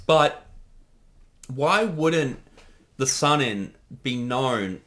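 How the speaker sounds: normal talking voice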